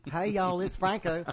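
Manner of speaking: high, slow Southern drawl; Southern accent. Retold or imitated. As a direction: drawl it like a Florida local. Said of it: "He'll start talking different now that he's in Florida".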